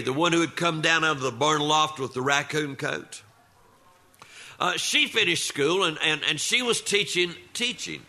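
usual speaking voice

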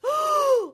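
sharp high gasp of fright; frightened gasp. Acted. Wife startled by a stranger, high frightened gasp. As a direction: gasp in alarm, pitched high. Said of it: "Yoshi went".

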